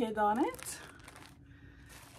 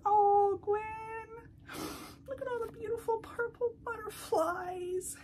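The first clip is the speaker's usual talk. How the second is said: moved to tears; 9 semitones higher, slower than usual. Real, not acted. Butterflies recalling late mother.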